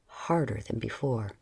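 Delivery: ordinary delivery, nothing marked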